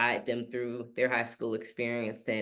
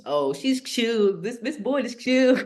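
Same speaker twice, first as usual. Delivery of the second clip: higher, slow, laid-back imitation of students; students sizing her up; 8 semitones higher, 4 dB louder than usual. Students deciding the new teacher is chill, lightly voiced. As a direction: lightly voice a relaxed teen.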